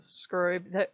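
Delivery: usual speaking voice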